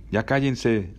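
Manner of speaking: mildly exasperated hushing; annoyed scolding. Acted. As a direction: tell noisy kids to shut up. Passenger at back scolds kids.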